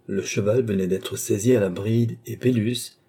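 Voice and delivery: ordinary delivery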